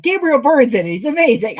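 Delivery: high, loud mock enthusiasm; gushing recovery. Wife sliding right over husband's correction, gushing loudly.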